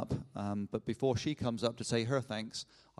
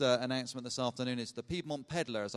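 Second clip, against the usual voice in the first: usual speaking voice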